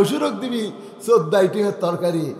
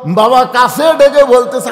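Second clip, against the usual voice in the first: ordinary talk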